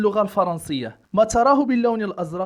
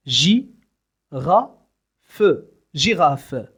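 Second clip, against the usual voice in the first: slow teaching; 4 semitones lower, much slower than usual. Shown as a demonstration. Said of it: "syllable-by-syllable teaching".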